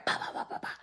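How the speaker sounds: hushed fantasy punches, breathless whisper; whispered pop pop. Retold or imitated. Picturing hitting her partner, whispered pop pop pop. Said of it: "in my head".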